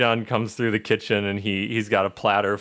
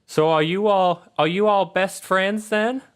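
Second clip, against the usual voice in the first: put-on high, louder, friendly little-girl question; high-pitched girl making new friends; curious Charlotte; 11 semitones higher, 4 dB louder than usual. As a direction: ask it as an eager young daughter. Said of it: "she asks you guys".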